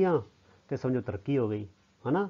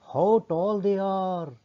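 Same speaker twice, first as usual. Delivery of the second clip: high, long-drawn exclamation of wonder; amazed; 6 semitones higher, 3 dB louder, much slower than usual. Shown as a demonstration. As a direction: marvel aloud, stretching every word. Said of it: "ਇਹ ਗੱਲ ਹੈਰਾਨੀ ਨਾਲ ਕਹਿ ਰਿਹਾ ਹੈ".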